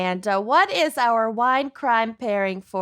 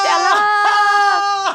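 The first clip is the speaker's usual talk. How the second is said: high, loud drawn-out call; excited shout; 9 semitones higher, 5 dB louder than usual. Real, not acted. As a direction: bellow Stella like Streetcar. Shouting the fan's name.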